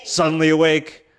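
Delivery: high, loud burst; sudden exclamation. Acted. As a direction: burst out as if jolted awake. Acting out lemur waking suddenly.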